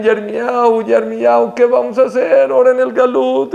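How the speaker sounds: high, pleading call without real sobs; retold tearful plea. Exiled people begging Yirmiyahu for guidance, imitated lament. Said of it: "venían con Yirmiyahu llorando".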